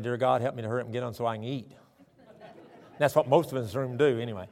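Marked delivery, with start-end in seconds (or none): 0.0-1.7 low, quick, softened mock prayer; hurried prayer. Hungry faster praying before a meal, hurried mock prayer. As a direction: rush through grace to get eating. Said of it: "I'm praying".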